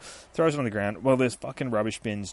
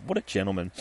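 normal talking voice

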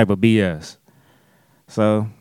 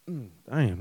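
a burp slips out; muffled burp before speaking; burping the words; 10 dB quieter than usual. Real, not acted. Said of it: "another burp".

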